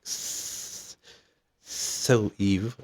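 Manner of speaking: hissing snake impression; speaking snake. Snake addresses Eve in the garden, hissing. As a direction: draw out the S in a long hiss. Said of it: "started speaking snake".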